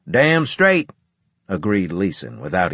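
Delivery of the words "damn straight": high, loud soldier's shout; emphatic agreement. A narrator's reading. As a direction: back him up loudly. Adjunct agrees with booming veteran.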